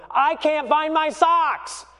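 put-on high, drawn-out kid wail; frantic child. Child running around in panic, acted.

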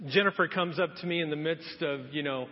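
ordinary talk

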